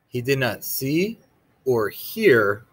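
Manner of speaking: ordinary delivery, nothing marked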